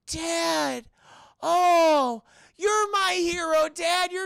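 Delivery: high, loud, hammed-up amazement; mock overjoyed boy. Boy discovers hero is dad, mock-overjoyed child voice. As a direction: cry out like a starstruck son. Boy recognizes his father.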